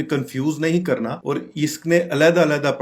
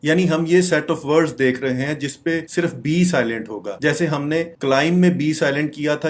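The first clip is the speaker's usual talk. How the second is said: ordinary delivery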